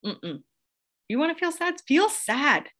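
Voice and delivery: put-on high, sing-song coo to a small child; imitating a parent soothing a child in a high voice. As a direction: coo it like a parent hushing a little one. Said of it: "I'd be like".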